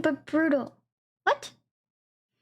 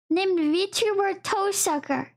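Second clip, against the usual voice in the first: normal talking voice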